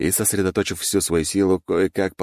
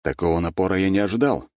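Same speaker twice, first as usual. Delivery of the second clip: plain reading voice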